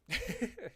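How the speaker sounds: short high laugh; high giggle. Real, not acted. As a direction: let out a quick high giggle. Laughs after humming.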